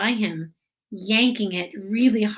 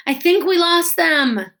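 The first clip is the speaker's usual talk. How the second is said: high, loud call in the dark; yelled; 8 semitones higher, 8 dB louder than usual. A narrator's reading. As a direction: shout out, relieved, to the group. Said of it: "someone yelled".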